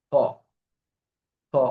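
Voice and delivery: p sound, both lips fully closed. Shown as a demonstration. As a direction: press both lips shut and pop a p. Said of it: "দুই ঠোঁট একদম বন্ধ করে".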